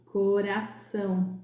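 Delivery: coração said slowly, nasal ão stretched; slow teacher demo of coração. Shown as a demonstration. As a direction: lean on the ão in coração. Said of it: "Com som ã e ão".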